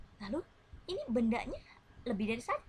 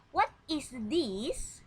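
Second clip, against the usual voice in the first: slow, rising, higher model question; 4 semitones higher, 3 dB louder, much slower than usual. Shown as a demonstration. Teacher reading a question aloud for pupils to echo, slow. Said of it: "You have to repeat after me".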